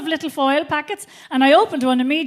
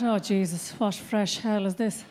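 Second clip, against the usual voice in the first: weary dread; 6 semitones lower, 7 dB quieter, slower than usual. Retold or imitated. Grumpy inner thought after crash.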